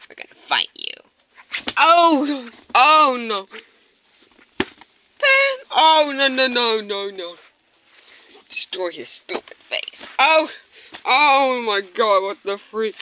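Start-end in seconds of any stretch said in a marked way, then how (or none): none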